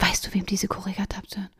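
retold grandma, whispering the question; grandma whispering to the other women; secretive whisper. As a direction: whisper it low and conspiratorial. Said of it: "kommt runter und sagt".

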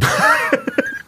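suppressed snort of laughter; stifled giggles. Real, not acted. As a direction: giggle held back behind closed lips. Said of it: "Du, hör auf zu lachen".

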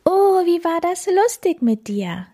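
hohe, freudige Katzenstimme; freudig aufgeregt. Acted. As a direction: sprudelnd vor Freude. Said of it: "freute sich die Plapperkatze und zappelte aufgeregt". (aufgeregt fröhliche Plapperkatze)

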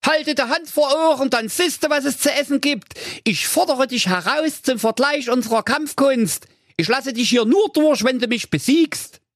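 high, anxious cartoon ninja; comic ninja voice. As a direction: mutter worried thoughts, high pitched. Ninja challenges.

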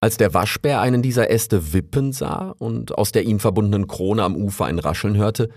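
plain reading voice

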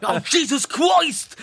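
laughing imitation of the homeless man. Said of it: "der Obdachlose so".

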